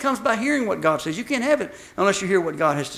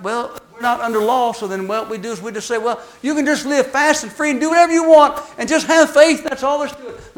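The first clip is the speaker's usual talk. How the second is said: mock carefree voice; 6 semitones higher, 8 dB louder than usual. Mocking careless believers.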